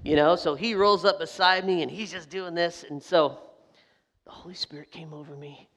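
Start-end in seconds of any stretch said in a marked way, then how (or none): 4.2-5.7 hushed whisper; whispered aside to the congregation. Pretend. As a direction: let it drop to a secret whisper. Confiding aside to audience.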